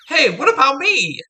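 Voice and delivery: high, loud whine of protest; indignant protest. Acted. Assistant left behind by the flirting prosecutor, loud indignant protest. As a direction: cry it out high, feeling left out.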